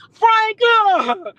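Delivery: lightly mock her calling out the name. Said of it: "with your big ass mouth calling my name".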